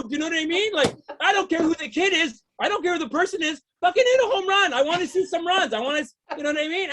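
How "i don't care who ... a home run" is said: ordinary talk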